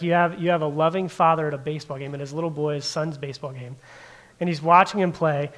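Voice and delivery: ordinary delivery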